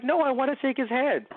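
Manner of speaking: high-pitched little ruler, imitated; lightly acted childlike royal protest; King of All. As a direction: pitch it up like a small child insisting. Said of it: "very high-pitched voice".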